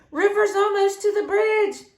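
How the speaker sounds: high-pitched urgent holler; hollered warning. A narrator's reading. Little Sammy warns everyone in the library, hollering. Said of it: "little Sammy hollered".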